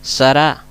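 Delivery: Sará with stress on the final syllable, rising; stress on last syllable. Shown as a demonstration. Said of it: "Fíjense bien la acentuación".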